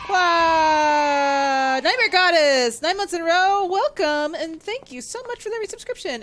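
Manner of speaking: loud, high, thrilled thanks; excited shout-out. Real, not acted. Streamer loudly thanks a viewer for resubscribing, thrilled. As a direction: yell your thanks to the subscriber.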